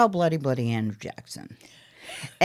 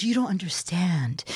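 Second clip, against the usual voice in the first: whisper; 6 dB quieter than usual. Retold or imitated. Old lady confides to mother.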